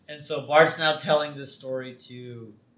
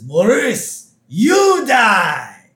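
loud, high villainous cry; hammed-up cartoon menace; [name]; 10 semitones higher, 7 dB louder, much slower than usual. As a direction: shout a death threat theatrically. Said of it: "[name] morphs out of it".

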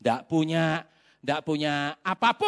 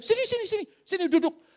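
high-pitched imitation of an eager host; eager welcome; 13 semitones higher, faster than usual. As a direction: usher the guest in, pitch way up. Host eagerly ushering him in.

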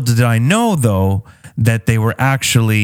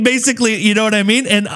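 ordinary delivery

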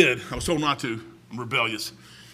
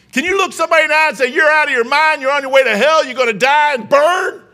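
loud, high, hammering each phrase; confrontational shout; 6 semitones higher, 6 dB louder than usual. Shown as a demonstration. Preacher confronting a sinner, loud and hot. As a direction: shout it in their face like a street preacher.

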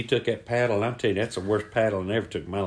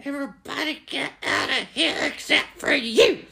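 furious coach; 14 semitones higher than usual. Acted. Red-faced coach clearing the room, high and furious. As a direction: squeeze it out high, seething.

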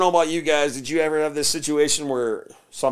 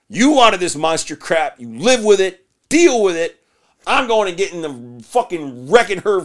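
rising, louder exasperated dad rant; building mock rant; 6 semitones higher, 6 dB louder than usual. Father scolding his son, building to a shout. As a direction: build from grumble to a yelling scold.

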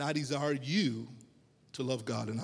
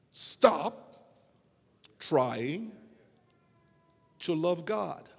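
emphatic restatement broken by long pauses; word-by-word emphasis; 3 semitones higher, much slower than usual. Real, not acted. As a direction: hit "stop", leave "trying" hanging, then "to love God". Restates his challenge word by word.

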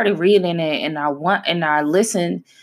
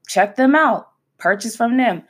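raised-pitch mock endorsement; upbeat plug; 6 semitones higher than usual. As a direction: urge followers on eagerly. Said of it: "post their stuff and be like".

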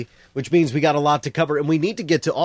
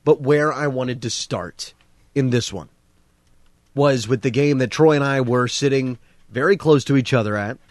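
ordinary delivery

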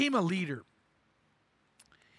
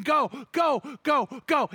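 high, louder chant of go; crowd scream; 5 semitones higher, 3 dB louder, slower than usual. Retold or imitated. Preacher voicing everyone screaming for Shea, high and loud. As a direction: yell him home. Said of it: "everyone's screaming".

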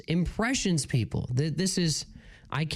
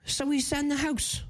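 put-on British accent; 8 semitones higher than usual. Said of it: "so continue British".

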